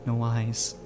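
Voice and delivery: ordinary delivery, nothing marked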